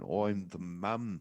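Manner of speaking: mock thick Irish accent. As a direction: ham up a heavy Irish brogue. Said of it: "In that thick-ass Irish accent".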